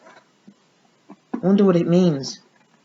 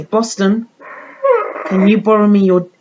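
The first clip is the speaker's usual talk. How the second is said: ordinary talk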